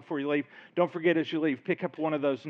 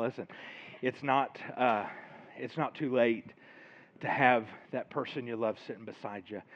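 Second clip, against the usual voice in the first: normal talking voice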